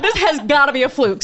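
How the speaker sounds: laughing through the words. Real, not acted. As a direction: giggle it out in disbelief. Laughing in disbelief.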